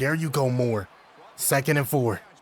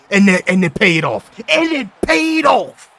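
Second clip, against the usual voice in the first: much higher, much louder joyful yell; excited shouting; 6 semitones higher, 11 dB louder than usual. Real, not acted. Gamer yelling as his fourth-down gamble pays off. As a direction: celebrate the gamble paying off.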